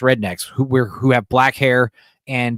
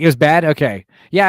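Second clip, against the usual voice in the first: ordinary talk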